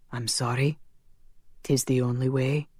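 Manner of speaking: sorrowful. Acted. Connor tearfully refuses her plea.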